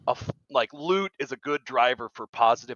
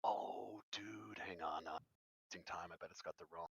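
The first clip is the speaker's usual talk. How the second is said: whispering aside; 5 semitones lower, 21 dB quieter than usual. Real, not acted. Caller fixing mic, muttering aside.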